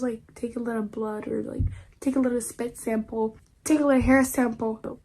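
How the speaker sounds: usual speaking voice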